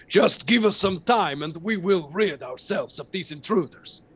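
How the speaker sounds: booming giant lightly voiced; frost giant voice. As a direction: speak like a confident giant. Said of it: "And the Frost Giants say".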